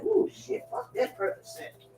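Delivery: hushed, breathy mock horror; a quiet "ooh, shit"; whispered aside. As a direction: half-whisper it to yourself. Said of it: "I was like".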